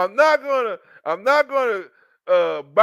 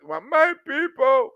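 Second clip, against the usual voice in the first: mock weepy; 6 semitones higher, slower than usual. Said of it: "This bitch said".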